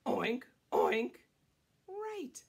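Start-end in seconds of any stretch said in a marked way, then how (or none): 0.1-1.2 two loud snorty oinks; pig oink. Shown as a demonstration. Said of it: "And what does he say?".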